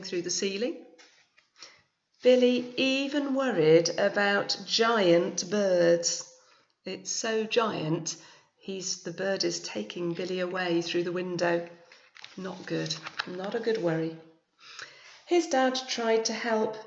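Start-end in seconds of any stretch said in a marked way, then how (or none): 2.2-6.2 story narration. A narrator's reading. Reads picture book aloud. 15.3-16.8 story narration. A narrator's reading. Reads picture book aloud.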